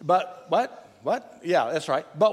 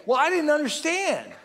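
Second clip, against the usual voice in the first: defensive excuse; 5 semitones higher than usual. Retold or imitated. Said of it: "and you say".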